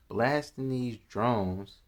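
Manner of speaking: ordinary talk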